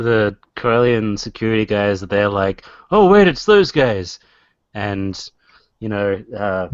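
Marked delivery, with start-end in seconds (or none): 2.9-4.2 higher, louder startled guard reaction; mock sudden recognition; alarmed guards. Security guards spotting the runaways, alarmed.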